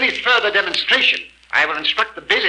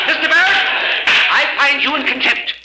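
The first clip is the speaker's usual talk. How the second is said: loud, much higher, stern; angry rebuke; 7 semitones higher, 3 dB louder than usual. Acted. Judge to mocking Berg, loud angry rebuke. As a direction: slap him down with the ruling.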